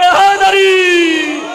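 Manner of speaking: slow, loud shouted call; rallying cry. Real, not acted. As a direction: lead the crowd in the cry, drawn out. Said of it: "बंदा एक नारा लगाए".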